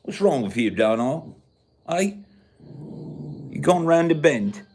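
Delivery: put-on English accent, needling; exaggerated British accent with swooping pitch; mock British accent. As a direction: scold someone in a hammed-up English accent. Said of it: "We talk like this".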